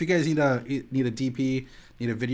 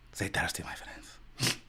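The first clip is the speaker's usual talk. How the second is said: low, whispery suave ad line; mock-suave whispered sign-off in a made-up accent; hushed catchphrase; 5 semitones lower, 10 dB quieter, slower than usual. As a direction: drop to a soft, low near-whisper.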